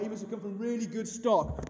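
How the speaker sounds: normal talking voice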